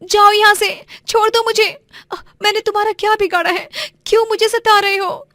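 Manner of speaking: high, loud, fast and frightened; young woman cornered by thugs, crying out high and loud; frightened plea. Acted. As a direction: shove the bullies off in a panicked high cry. Harassed girl pushes thugs away.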